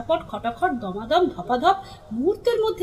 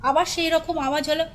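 usual speaking voice

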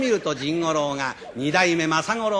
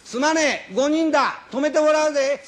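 much higher, louder rough traveler hollering for a room; calling out; 11 semitones higher, 5 dB louder than usual. Acted. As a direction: holler across the street to the innkeeper. Rough guest calls out loudly. (荒っぽい客が大声で呼びかける)

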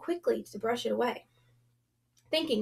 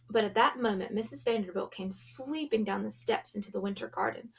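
ordinary delivery, nothing marked

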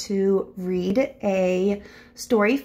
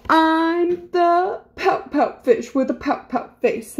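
higher, rhythmic pouty refrain; glum pouting fish; 5 semitones higher than usual. Acted. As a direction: chant it like a sulky little fish. Voices the gloomy fish's reply.

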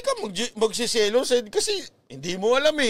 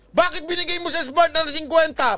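mock complaint; 7 semitones higher than usual. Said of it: "Nalaman nung Globe".

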